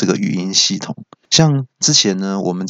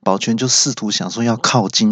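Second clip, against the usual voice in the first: normal talking voice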